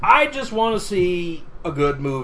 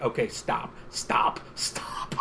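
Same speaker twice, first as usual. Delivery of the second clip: fading whispered pleas to stop; whispering; 7 semitones lower, 12 dB quieter, much slower than usual. Retold or imitated. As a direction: whisper it, begging them to quit. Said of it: "I'm like".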